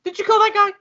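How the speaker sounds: a high, sharp disbelieving squeak; shocked question. Acted. Retelling the stunned question.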